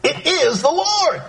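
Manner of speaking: high, slow, sure declaration; confident. Retold or imitated. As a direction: announce it with total certainty. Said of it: "John says confidently".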